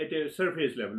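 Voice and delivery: ordinary delivery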